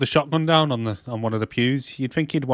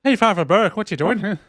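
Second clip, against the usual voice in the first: put-on higher playful Irish accent; 8 semitones higher than usual. Joining the Father Ted joke, light Irish accent. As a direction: greet him Irish-style. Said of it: "Irish accent".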